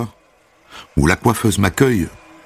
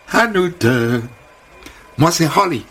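high, gushing hairdresser greeting; sing-song high welcome; bubbly American hairdresser; 7 semitones higher than usual. Acted. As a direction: chirp it like an overfriendly American. Holly introduces herself.

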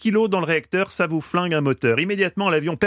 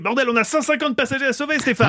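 exasperated; 8 semitones higher than usual. Acted. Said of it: "de la tension, de l'urgence".

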